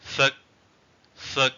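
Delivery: "Sek" with a short vowel, cut off at the final stop. Shown as a demonstration. Teacher demonstrating the eighth tone, short "Sek". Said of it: "example with short vowels".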